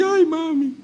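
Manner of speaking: mild mock whimper of a child; a hint of a beaten child clinging to mom; crying child. Child hugging his punishing mother, lightly mock crying. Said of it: "llorando así".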